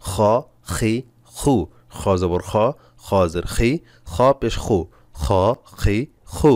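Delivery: খ, খি, খু scraped at the back of the throat; rasping kh. Shown as a demonstration. Teacher demonstrating the rattling sound, rasping খ. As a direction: make a rough rattle on every খ. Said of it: "খর খর একটা আওয়াজ হবে".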